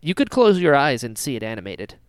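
ordinary delivery

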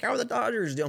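high, sweet mock neighbor. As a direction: chirp it way up like the nosy neighbor. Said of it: "so she's like".

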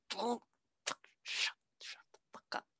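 hissed whisper to hush her; mock secretive whisper. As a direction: whisper it urgently so nobody hears. Rabbit hushing Alice secretly.

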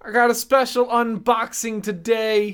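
high, slow hammy YouTuber voice; Voldemort as YouTuber. Pretend. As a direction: do Voldemort hosting an unboxing. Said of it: "Voldemort being like".

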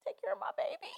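soft breathy whisper, half-joking farewell. Retold or imitated. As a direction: whisper it like handing over something precious. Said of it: "and I was like".

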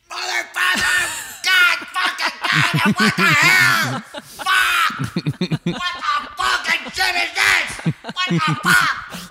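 full-volume, high-pitched imitated meltdown; screaming curses. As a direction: scream each curse drawn out, as if alone in the car. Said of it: "Regis fucking screaming?".

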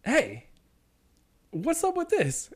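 high put-on stranger's voice; European man. European stranger behind him asking about the line, pitched high. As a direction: climb high on the mimicked question. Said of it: "very European".

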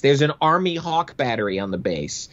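ordinary delivery

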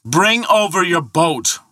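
high, drawn-out shouted order; roaring. Acted. Thor ordering the ferryman to bring the boat, roaring. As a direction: roar it across the channel. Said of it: "roared Thor".

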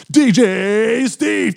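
high, loud, stretched-out DJ call; hyped DJ. Retold or imitated. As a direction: drag out the name like a club hype man. Imitates DJ announcing himself.

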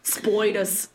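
"spoiders" in mock Australian; fake Aussie accent. Hosts practising spiders, badly faked Aussie accent. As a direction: ham up the Australian vowel. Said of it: "that was not an Aussie accent".

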